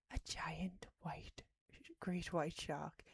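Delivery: slow, hushed whisper; dramatic whisper. Acted. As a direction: whisper it like a horror trailer. Dramatic shark description.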